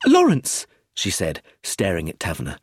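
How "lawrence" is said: high, loud cry of alarm; frantic high-pitched outburst; distraught cry. A narrator's reading. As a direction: cry the name out in panic. Arrested Brenda cries out for Lawrence.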